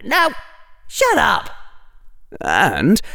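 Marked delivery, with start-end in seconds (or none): 0.0-1.5 lightly acted bickering, higher than the narration; bicker, then cut the discussion off; inner argument, exasperated. Harry quarrels with his inner voices.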